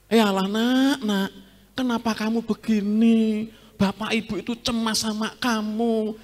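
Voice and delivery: dramatized mother. Shown as a demonstration. Preacher dramatizes Mary fussing over her lost son. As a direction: overplay a worried mother's lament. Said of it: "Itu kalau didramakan".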